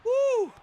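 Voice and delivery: very high, loud "woo"; excited whoop. Real, not acted. Commentator reacting to the match action, excited whoop. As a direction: whoop at a big move.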